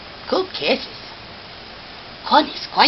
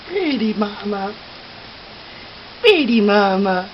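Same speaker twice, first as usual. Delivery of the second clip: usual speaking voice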